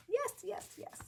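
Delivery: ordinary talk, nothing marked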